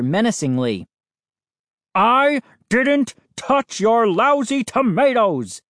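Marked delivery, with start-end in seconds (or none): 1.9-5.6 high, emphatic angry roar. A narrator's reading. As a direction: roar an indignant denial. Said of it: "he roared".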